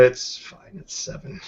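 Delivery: ordinary talk, nothing marked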